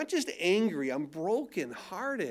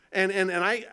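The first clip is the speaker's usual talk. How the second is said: ordinary delivery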